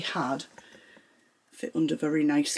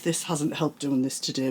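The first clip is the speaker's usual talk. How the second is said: ordinary talk, nothing marked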